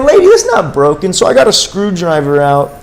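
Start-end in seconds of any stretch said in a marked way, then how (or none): 0.0-1.1 high, louder mock exasperation; exasperated retort. Man retells telling the lady her car is fine, high exasperated yell. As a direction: throw it up high like you can't believe her.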